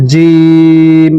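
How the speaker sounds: slow sustained Quranic জিম; long drawn-out jim. Shown as a demonstration. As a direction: stretch জিম, holding the i for three alif lengths. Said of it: "এটি তিন আলিফ পরিমাণ টেনে পড়তে হবে".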